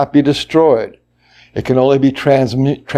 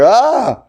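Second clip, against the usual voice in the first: high-pitched mock scream of fear; frightened cry; 11 semitones higher than usual. Shown as a demonstration. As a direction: demonstrate a startled scream. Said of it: "not like the voice I'm using now".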